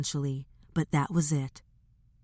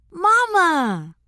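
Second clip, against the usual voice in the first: put-on excited child's cry; 13 semitones higher, 7 dB louder than usual. Boys greet their mother at door.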